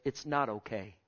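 soft, low and firm; hushed low warning; quiet firm statement. Real, not acted. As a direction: say it quietly, dead serious.

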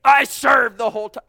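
loud, indignant protest; self-righteous outburst. Acted. Elder brother protesting to father, indignant shout. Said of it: "self-righteous there".

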